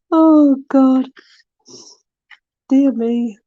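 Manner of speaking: helpless laughter. Real, not acted. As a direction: crack up completely. Laughing hard at rhyme.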